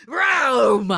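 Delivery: loud, high mock engine roar; car vroom. Car flying out of its parking spot, loud imitated vroom. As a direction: roar like a cartoon car lurching forward. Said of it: "The car is like".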